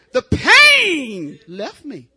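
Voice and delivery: slow, loud, stretched-out proclamation; slow loud testimony cry. Real, not acted. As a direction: cry it out slowly, with force. Testifying about childbirth.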